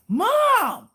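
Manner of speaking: high, loud mock-frightened yell; startled son. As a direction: cry out like a spooked kid. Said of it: "Did I scare you?".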